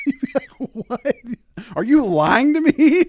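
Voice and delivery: high, loud, laughing disbelief. Real, not acted. Joking about a rare pilot, laughing high and loud. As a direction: laugh through the shocked question.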